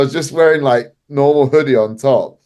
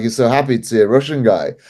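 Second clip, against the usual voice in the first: usual speaking voice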